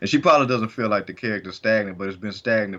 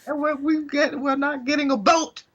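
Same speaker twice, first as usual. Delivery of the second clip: mock falsetto. Joking to co-host about being stuck, mock falsetto. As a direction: squeal it out in a put-on high voice. Same falsetto bit continues.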